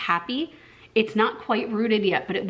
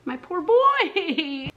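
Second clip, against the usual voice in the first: high, long-drawn mock whine; stretched-out, high lament for a pet; sad wail; 7 semitones higher, much slower than usual. Real, not acted. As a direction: wail pityingly, half laughing. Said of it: "I'm so sad".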